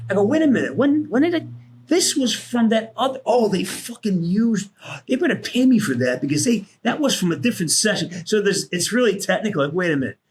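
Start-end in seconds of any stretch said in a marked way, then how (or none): 0.2-8.1 retold indignant protest. Acting out objection to reused recordings.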